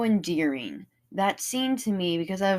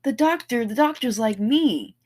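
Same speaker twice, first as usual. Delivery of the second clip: high, loud, thrilled recognition; light up like a kid spotting their hero; a fan shouting happily that a character is like them; delighted fan; 8 semitones higher, 4 dB louder than usual. Retold or imitated. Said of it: "their faces light up when they're like".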